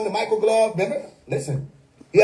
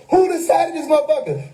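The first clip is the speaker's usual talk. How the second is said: retold yelling; 6 semitones higher than usual. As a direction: act out an indignant streamer's protest. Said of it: "yelling at the screen".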